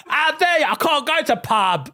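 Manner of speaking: hammed-up Cockney outrage; mock EastEnders. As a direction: overplay a soap outburst.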